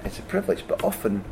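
ordinary talk, nothing marked

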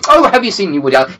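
high, quick mock-critic gush; broadsheet critic. Broadsheet critics recommend Woody Allen, gushing mockingly. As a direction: twitter like a wine-guzzling critic. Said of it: "the wine guzzling broadsheet critics are going".

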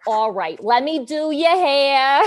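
a broad Long Island accent, slow. Shown as a demonstration. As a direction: drawl it like The Nanny's hairdresser. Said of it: "I'm picturing, like, The Nanny".

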